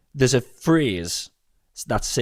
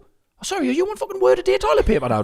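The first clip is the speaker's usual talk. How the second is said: mocking retort; 9 semitones higher than usual. Imagined mocking retort to a mate.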